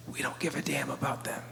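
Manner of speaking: low, hushed whisper; whispered indifference. Acted. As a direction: whisper it as the unspoken message. Voices systems staring back.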